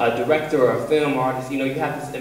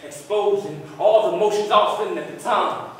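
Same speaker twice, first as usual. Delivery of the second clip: rising, high emotional declaiming; spoken-word performance; 8 semitones higher than usual. Acted. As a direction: build from confession to high outpouring.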